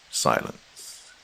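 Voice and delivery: ordinary delivery, nothing marked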